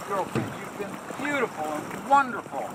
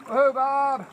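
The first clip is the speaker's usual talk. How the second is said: cheerful hail to a passing boat; calling out; 7 semitones higher, 5 dB louder, slower than usual. Real, not acted. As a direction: shout it across the water.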